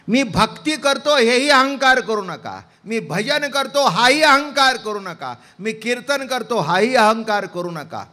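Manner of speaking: loud, high sermon cadence; fervent kirtan preaching. Acted. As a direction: preach it to the crowd with fire. Kirtan preaching at full force. (जोरदार कीर्तन प्रवचन)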